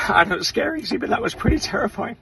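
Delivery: talking through rising emotion; fighting back tears. Real, not acted. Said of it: "I'm getting emotional".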